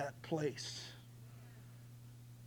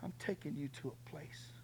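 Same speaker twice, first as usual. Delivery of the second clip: God's gentle voice; 13 dB quieter than usual. Retold or imitated. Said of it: "he said".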